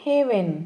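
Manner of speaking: హెవెన్ said as a careful word on its own; హెవెన్ with a falling end; model pronunciation. Shown as a demonstration. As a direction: pronounce హెవెన్ clearly for learners. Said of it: "ఎలా పలకాలి?".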